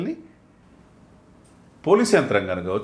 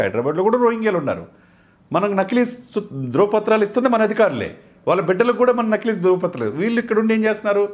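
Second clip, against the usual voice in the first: higher, louder indignant outburst, fast at the end; angry rant; 9 semitones higher, 5 dB louder than usual. Real, not acted. Debate guest railing at officials issuing fake papers, angry and loud. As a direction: fire off the accusation.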